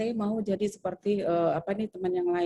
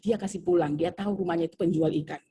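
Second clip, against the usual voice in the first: ordinary talk, nothing marked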